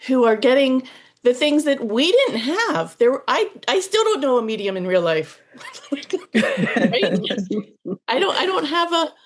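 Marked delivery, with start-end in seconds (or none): 5.4-9.1 laughing. Real, not acted. Laughs about lacking a medium.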